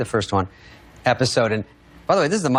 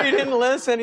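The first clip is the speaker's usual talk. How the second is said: put-on whiny child voice; 9 semitones higher than usual. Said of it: "her children are like".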